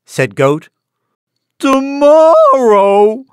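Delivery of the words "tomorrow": high, stretched-out whine; whining. A narrator's reading. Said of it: "whined Donkey".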